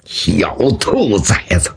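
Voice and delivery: low, slow curse; acted angry curse. Beaten swordsman curses his young foe, low acted anger. Villain curses after slapping table.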